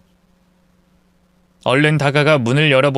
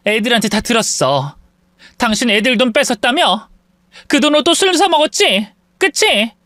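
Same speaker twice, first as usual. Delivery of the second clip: accusing; 7 semitones higher than usual. Acted. Wife accusing husband.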